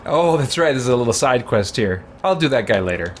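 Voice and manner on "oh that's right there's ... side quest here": ordinary delivery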